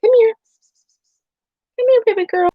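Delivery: high, sweet coaxing to a scared cat. Real, not acted.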